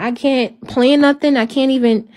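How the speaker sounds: ordinary delivery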